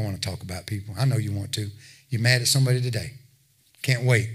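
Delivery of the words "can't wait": normal talking voice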